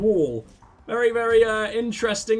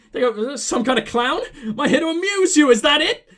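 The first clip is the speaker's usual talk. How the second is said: louder and higher, a laughing mock rage; mock offended; 6 semitones higher, 6 dB louder than usual. Dealer takes offense at the player, acted angry and loud. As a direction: blow up in hammed-up outrage, laughing through it.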